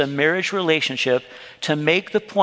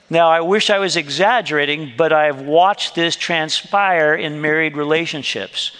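ordinary talk

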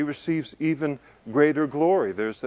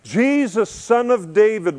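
raised, calling voice, pitched higher and louder; crying out; 8 semitones higher, 5 dB louder than usual. Retold or imitated. Blind beggar calling out to passing Jesus, raised cry. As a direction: call out to Jesus for help. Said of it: "And he cried out".

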